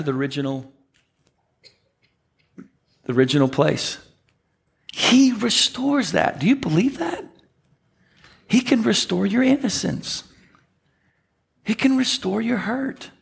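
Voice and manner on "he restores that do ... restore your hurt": rising preaching. Real, not acted. Pastor urging the congregation to believe, fervent preaching. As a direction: lift it up for the congregation.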